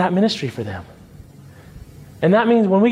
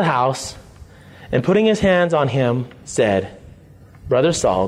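scripture reading; 8 semitones lower than usual. Acted. Reads Acts 9 scripture aloud.

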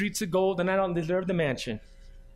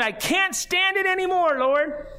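weary believer; 10 semitones higher, slower than usual. Retold or imitated. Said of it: "once you think you've gotten to your absolute limit".

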